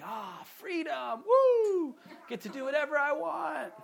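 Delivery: hammed-up whoop of freedom; mock carefree student. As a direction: play a freshman gone wild. Mock student let loose at uni.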